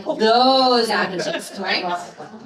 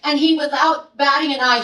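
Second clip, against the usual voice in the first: ordinary talk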